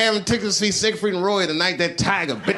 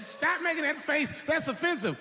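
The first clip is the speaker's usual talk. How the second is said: mock scolding; 9 semitones higher, 6 dB quieter than usual. Everyone mad, scolding.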